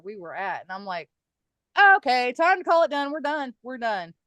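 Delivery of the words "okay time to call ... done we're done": louder, higher, shutting it down fast; firm loud stop. Retold or imitated. To friends at the Ouija board, a loud firm stop. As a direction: slam the session shut, louder and higher. Child sees spirits, ending session.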